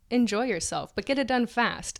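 normal talking voice

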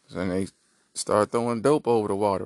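ordinary delivery

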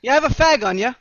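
high, loud camp British voice; English accent. Acted. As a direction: ask it like a cheeky Londoner. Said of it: "we got an English homosexual over here".

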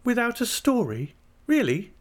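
put-on high, girlish questioning voice; Dora. A narrator's reading. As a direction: ask as the child-wife, pitched right up. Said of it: "inquired Dora".

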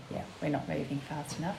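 ordinary talk

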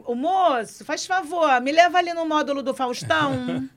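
put-on Carioca accent. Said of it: "botar a boca mole, assim, pra carioca". (pedindo carona com sotaque carioca de boca mole)

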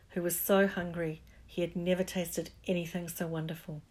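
ordinary delivery, nothing marked

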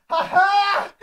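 high, loud shouted cackle; Tom Cruise meme laugh. Pretend. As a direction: let rip the meme laugh. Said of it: "He does the meme".

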